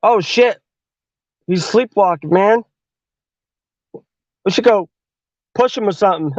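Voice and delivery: a hint of an eager fifth grader; kid voice. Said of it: "the little bastard kid's like".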